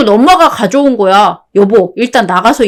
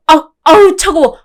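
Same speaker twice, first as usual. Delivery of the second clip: yelp at the cold; 4 semitones higher than usual. Acted. Ice down his shirt.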